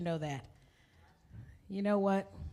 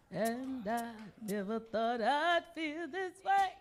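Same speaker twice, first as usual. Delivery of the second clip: lightly voiced diva crooning the line, quiet; 4 semitones lower, 12 dB quieter than usual. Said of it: "I could do my [name] impression".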